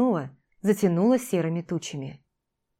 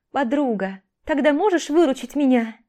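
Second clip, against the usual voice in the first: excited babble; 6 semitones higher, 3 dB louder than usual. A narrator's reading. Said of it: "Воодушевлённо пролепетала Ольга".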